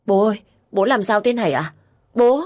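high, urgent call to a father; acted distress, building to a cry; tearful panic. A narrator's reading. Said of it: "Lâm nước mắt nhòe nhòe". (hoảng loạn gọi bố)

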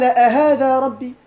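أهذا ربي slow and loud, rebuking; disbelieving, reproachful question; scornful question. Shown as a demonstration. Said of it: "استفهاما إنكاريا توبيخيا".

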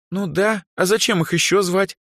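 high, condescending explanation. A narrator's reading. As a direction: state the obvious patronisingly. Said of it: "снисходительно произнесла Дроу".

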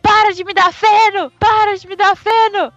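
high, loud frantic yell from inside; crazed yelling. Retold or imitated. Thumb trapped in the cow's stomach yells at the maid, high and loud. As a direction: scream like a madman trapped. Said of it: "gritando igual um maluco".

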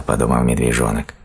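usual speaking voice

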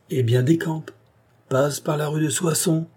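lively, bossy send-off; brisk order. A narrator's reading. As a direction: send the boy packing. Mother sends her boy off.

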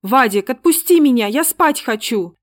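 a frightened mother screaming to be released; shout. Acted. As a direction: yell it out in panic. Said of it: "закричала".

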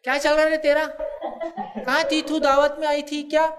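mother gossiping on the phone with her sister, high and laughing; chatty gossip. Retold or imitated. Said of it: "اب ماں phone کری اپنی بہن کو".

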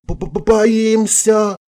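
very high stuttering squeak; acted quivering-lipped fright; trembling. A narrator's reading. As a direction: stammer it high, feigning terror. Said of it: "дрожащими губами произнесла я".

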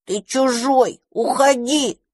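put-on sulky child voice. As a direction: push him away, pouting. Dragon drives him away.

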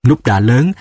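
usual speaking voice

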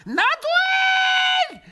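put-on breathless old man shrieking high. A narrator's reading. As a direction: screech it, choking on his words. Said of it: "завопил опять старикашка, задыхаясь и брызгаясь".

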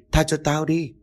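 put-on a terrified old man's rising plea; desperate pleading. A narrator's reading. Old man begging for mercy.

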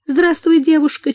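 high chirpy bird voice; chirping bird person. A narrator's reading. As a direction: chirp it like a canary. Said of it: "чирикнула она".

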